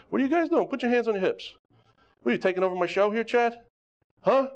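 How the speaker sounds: half-joking disbelief at the students; surprised. Real, not acted. Instructor catching students doing it wrong, high mock exasperation. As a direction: pitch jumps way up, mock exasperated.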